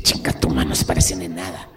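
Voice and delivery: low, loud dismissive comeback; mock angry retort. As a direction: snap back in a low, forceful voice. Teller's retort to friend's theory. (réplica enojada, grave y fuerte)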